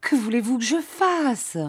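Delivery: high-pitched, acted tearful wail; despairing high cry; sobbing plea. A narrator's reading. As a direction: wail it helplessly, as if through sobs. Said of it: "Elle sanglotait".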